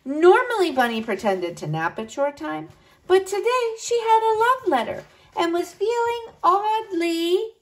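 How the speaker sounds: plain reading voice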